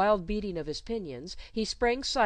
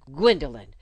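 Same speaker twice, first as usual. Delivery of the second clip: sharp, much louder call of the name; annoyed exclamation; 3 semitones lower, 11 dB louder than usual. Acted. Governess catching her pupil crying, annoyed exclamation. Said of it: "she exclaimed, annoyed".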